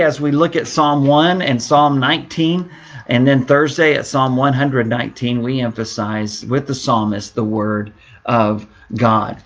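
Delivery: ordinary talk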